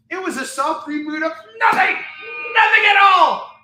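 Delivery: high, loud ranting yell; outraged shout. Real, not acted. Seller yelling his verdict on the reboot. Climax of rant.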